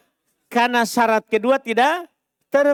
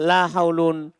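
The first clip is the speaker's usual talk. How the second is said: slow, lower, falling Arabic phrase; measured reading of la haulun wala quwatun; slow recitation; 7 semitones lower, slower than usual. Shown as a demonstration. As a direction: recite it deliberately to the class. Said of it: "Kembali bacaan di awal".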